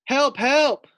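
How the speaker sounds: high, loud squeal of panic; panicked cry. Acted. Scared pig Fluffy squeals for help at the masked firefighter. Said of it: "thought Fluffy".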